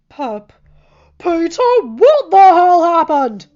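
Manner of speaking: loud, slow alarmed yell. A narrator's reading. Father figure yelling at the injured boy in alarm. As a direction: bellow down the hallway in alarm. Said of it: "Tony's yelling".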